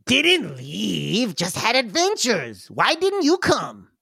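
high whiny goblin; player goblin voice. Acted. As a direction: whine back at the brother.

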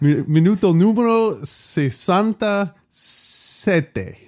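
minuto numero sesanta sete slowly, high pitch; careful Italian phrase. Shown as a demonstration. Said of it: "You got it".

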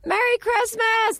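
jubilant movie ending. Acted. Greeting everyone who annoyed her, mock jubilant George Bailey style. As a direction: hail each one in an overjoyed high voice. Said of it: "That's me at, at the end of my movie".

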